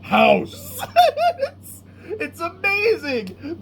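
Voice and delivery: laughing through the words; amazed, breaking into high laughter; cracking up. Real, not acted. Retelling a favorite absurd movie moment, cracking up.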